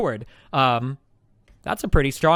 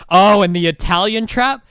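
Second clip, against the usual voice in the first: ordinary delivery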